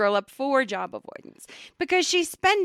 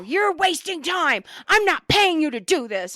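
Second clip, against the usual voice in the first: higher, sharp scolding yell; retold yell; 6 semitones higher, 4 dB louder than usual. Boss yelling at worker over wasted time, loud. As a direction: bark it at her like a boss losing it. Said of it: "he starts yelling at her".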